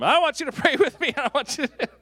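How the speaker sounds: high, loud mock yell with laughter; a pastor imitating a kid shouting, high and laughing. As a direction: yell it like an excited kid, cracking up. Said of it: "this kid starts yelling".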